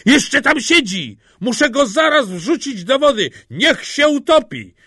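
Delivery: high, loud mock-terrified shouting; theatrical pretend panic; feigned fright. A narrator's reading. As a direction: shout as if scared, laying it on thick. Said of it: "udając, że się boi".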